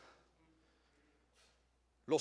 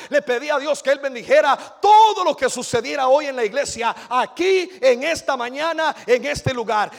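louder, higher, fervent sermon drive; testimony preaching; 7 semitones higher, 13 dB louder than usual. Acted. Preacher telling the church how he prayed, fervent. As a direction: preach it with mounting joy.

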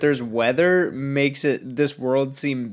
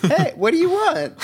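high, jokey 'hey' greeting; casual lion; 13 semitones higher than usual. Retold or imitated. Giant lion greeting a palace visitor, comically casual. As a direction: toss it out in a silly, much higher voice. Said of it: "using that tone of voice".

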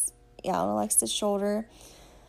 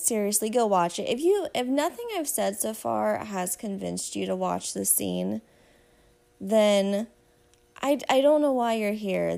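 ordinary delivery

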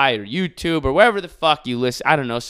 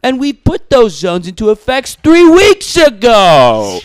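high, slow, put-on Cuomo voice; pompous governor announcement, drawn out; Cuomo impression; 10 semitones higher, 11 dB louder, slower than usual. Podcaster mocking the governor's briefing, pompous slow voice. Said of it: "That's how he talks".

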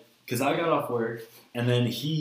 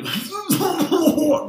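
a hammed-up hacking cough, higher and louder; mock coughing fit; 6 dB louder than usual. Retelling his first Newport, faking the coughing fit. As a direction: choke it out like a first drag gone wrong. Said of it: "you know, like, coughing".

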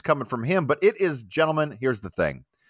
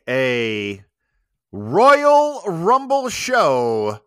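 announcer; 3 semitones higher, 4 dB louder, much slower than usual. Acted. Hyped guest introduction.

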